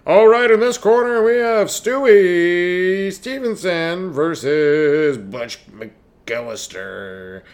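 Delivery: loud, drawn-out boxing announcer; ring announcer. Acted. Fight announcer intro.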